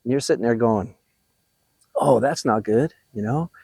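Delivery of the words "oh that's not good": low, mildly dejected mock reaction; feeling bad. Coach imitating someone criticized by a teacher, low and dejected. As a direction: sink a little, as if scolded. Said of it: "I feel bad".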